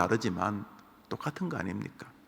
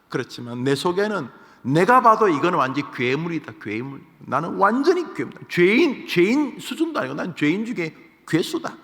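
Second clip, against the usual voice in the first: higher, louder anguished outcry as Paul; Paul rising to a loud, higher confession; Paul's confession; 7 semitones higher, 6 dB louder than usual. Retold or imitated. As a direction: cry out your own wretchedness. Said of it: "이 사람 정직하게 말한 것입니다".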